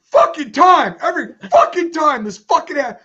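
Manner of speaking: high, loud furious curse; ranting tirade. Retold or imitated. Janitor cursing at the clogged toilet, furious shout. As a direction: imitate the janitor exploding in disgust. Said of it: "he goes on a fucking tirade".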